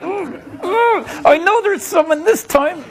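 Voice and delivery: high, excited ooh-ooh of a nosy onlooker; eager audience. Retold or imitated. Magician mocking the audience's eager peeking, high and excited. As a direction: squeal like a kid who thinks he caught you. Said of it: "They're like".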